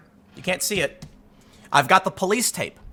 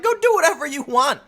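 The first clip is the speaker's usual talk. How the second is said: mock dismissive cop; 9 semitones higher than usual. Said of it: "the lady cop's like".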